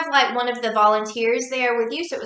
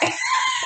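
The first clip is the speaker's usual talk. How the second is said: high laugh; 3 dB quieter than usual. Real, not acted. Laughing at cult-like chanting.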